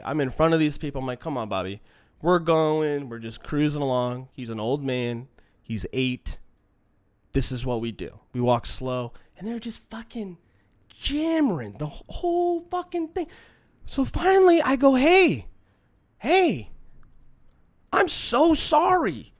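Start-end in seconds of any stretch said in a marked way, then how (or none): 1.2-9.1 low, soft, easygoing murmur; calm coaxing. Retold or imitated. Owner walking his old dog past hecklers, calm coaxing. 15.0-19.3 mock apology.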